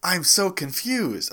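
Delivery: normal talking voice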